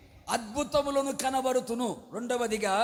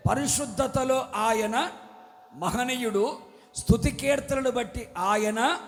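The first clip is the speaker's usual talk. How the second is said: louder, ringing pulpit declaration; preaching at full force; 4 dB louder than usual. Acted. Preacher blessing his congregation, loud fervent proclamation. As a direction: proclaim each blessing over the crowd.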